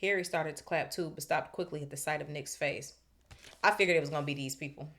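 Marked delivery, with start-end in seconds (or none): 3.6-4.9 quick aside. Real, not acted. Fast aside to audience.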